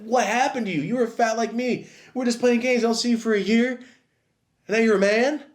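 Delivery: put-on high, loud incredulous kid; shocked disbelief. Kid stunned seeing his buff friend. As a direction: gape at your friend who suddenly grew up.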